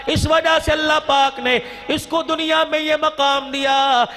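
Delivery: ordinary talk, nothing marked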